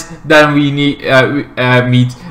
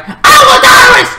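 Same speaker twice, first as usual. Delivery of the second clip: put-on high, screamed baby demand; newborn baby impression; 4 dB louder than usual. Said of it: "and he's like".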